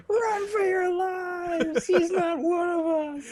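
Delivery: high, drawn-out alarm call; hammed-up movie panic, each word stretched; mock panicked cry. Bystander warning the crowd about the odd customer, mock panic.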